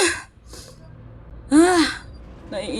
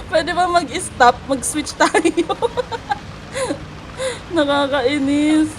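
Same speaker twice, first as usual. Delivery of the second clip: ordinary delivery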